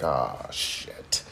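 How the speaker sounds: hushed, breathy cursing under the breath; low hushed dread; muttered curse. Retold or imitated. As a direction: swear quietly to yourself, dreading it. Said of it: "I'm like".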